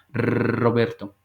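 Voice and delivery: "Roberto" slow with a trilled Spanish r; exaggerated rolled r. Shown as a demonstration. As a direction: roll the r in "Roberto" for practice. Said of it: "pueden hacerlo en casa". ("Roberto" lento con erre vibrada)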